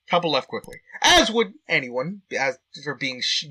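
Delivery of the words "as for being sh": ordinary talk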